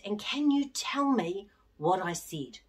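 unvoiced hush; hushed coaxing whisper. Real, not acted. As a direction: whisper the question like a secret. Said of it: "quiz question to children".

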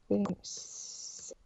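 slow, breathy whisper. Real, not acted. Reader rereads her own line in a slow whisper. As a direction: breathe it out slowly, no voice.

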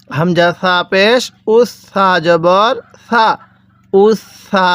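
slow spelling, drawn out, then উস সা with the s held; doubled consonant. Shown as a demonstration. Teacher showing how to learn it, slow doubled s. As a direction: lean on the s linking উস to সা. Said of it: "এরকমভাবে শিখব".

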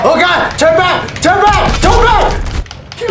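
panicked shout. Real, not acted. Gamer screaming at his tank to retreat, frantic. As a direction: yell turn back in panic.

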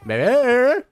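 loud high call. Real, not acted. Calling the missing baby.

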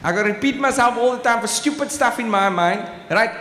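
normal talking voice

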